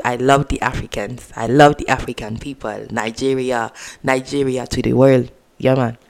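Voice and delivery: put-on a light, soft Nigerian accent; a gentle hint of a Nigerian accent. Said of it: "I gave a little accent".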